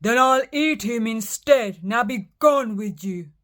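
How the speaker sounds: loud troll dismissal; loud troll sending the goat away. Acted. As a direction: shoo him off loudly.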